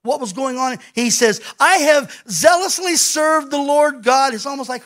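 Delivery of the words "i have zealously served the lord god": loud, higher, slow outburst of frustration; exasperated protest at full voice; frustrated Elijah. Acted. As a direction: pour out the hurt, slow and loud. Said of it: "talks out of his frustration".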